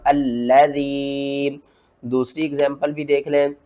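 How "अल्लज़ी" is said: slow chanted अल्लज़ी; Quran recitation. Shown as a demonstration. Teacher shows the zabar on alif, slow recitation. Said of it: "किस तरह?".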